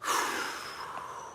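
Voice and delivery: long breathy exhale; heavy sigh. Real, not acted. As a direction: let out a long, weary sigh. Sighs after dismissing the report.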